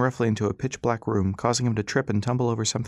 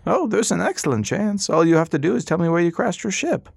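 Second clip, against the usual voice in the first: put-on much higher and louder, cunning old gentleman; old doctor; 8 semitones higher, 4 dB louder than usual. Old doctor demanding the ship's location, high and sly. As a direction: sweetly press him for the ship.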